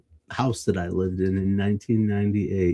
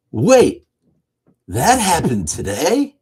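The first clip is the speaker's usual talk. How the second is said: excited; 5 semitones higher, 7 dB louder, slower than usual. Acted. Announcing the segment title.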